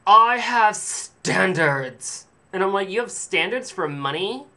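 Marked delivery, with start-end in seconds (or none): 0.1-2.3 very slow, loud, high haughty declaration; snobby saleswoman. Retold or imitated. Snobby cashier haughtily answering a customer. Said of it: "She's like". 2.9-4.4 high, rising disbelief; incredulous. Retold or imitated. Customer incredulously challenging a snobby cashier.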